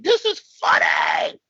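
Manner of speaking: barely holding in the laughter; inner glee. Retold or imitated. Hiding delight from his wife, slow high glee. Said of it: "But on the inside, I'm like".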